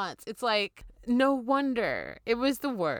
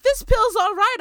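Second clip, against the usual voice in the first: much higher and louder, delighted; mock cheerful relief; 13 semitones higher, 8 dB louder than usual. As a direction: sell it like great news. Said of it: "I was like".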